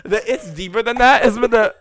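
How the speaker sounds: high and loud through laughter; barely getting words out for laughing. Real, not acted. Repeating the stranger's line, laughing hard.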